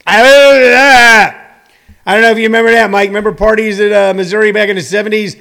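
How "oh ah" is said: put-on loud, high drunken wail; drunken groan. Comedian imitating someone drunk on Russian vodka, loud drunken groan. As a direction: wail wordlessly like a hammed-up drunk. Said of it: "Russian vodka made me where I couldn't walk".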